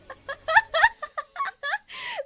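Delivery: shrill burst of giggling; high squealing laugh. Real, not acted.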